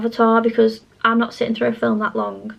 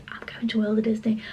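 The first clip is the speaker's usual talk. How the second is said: a breathy whispered goodbye, sneaking off; whispered aside; 6 dB quieter than usual. Retold or imitated. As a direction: whisper it as if slipping away unnoticed. Sneaking off, leaving Nick.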